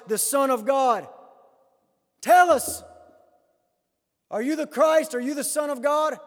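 high, loud insistent demand. Retold or imitated. Preacher voicing the high priest pressing Jesus to answer, loud. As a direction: press him hard for an answer. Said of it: "he demands an answer from Jesus".

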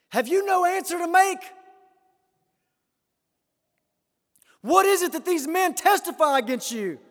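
high, loud demanding challenge; irritated high priest. Retold or imitated. Preacher voicing the high priest demanding an answer, high and loud. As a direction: bark the question at the silent prisoner. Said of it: "the high priest stood up and said, it's irritating to him".

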